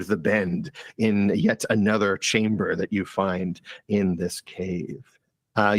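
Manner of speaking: low, even scene-setting narration; game master narration. Acted. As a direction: describe the cavern like a storyteller. Game master sets the cave scene.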